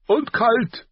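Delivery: loud, high, curt grumble; grumbling king. A narrator's reading. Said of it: "brummte Robert". (laut brummelnder König)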